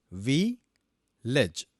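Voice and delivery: vi-lej said syllable by syllable, each part separate; syllables split. Shown as a demonstration. Teacher splitting vi-lej for students. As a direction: break vi-lej into its two syllables. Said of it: "The word village has two syllables".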